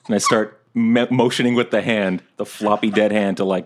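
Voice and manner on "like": ordinary delivery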